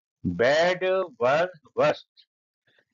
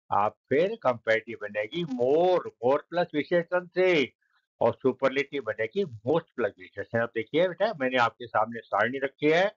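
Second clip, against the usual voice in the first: usual speaking voice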